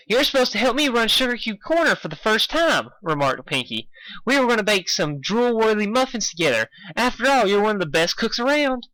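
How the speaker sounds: high bubbly pony voice; Pinkie voice. A narrator's reading. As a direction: chatter on brightly like Pinkie.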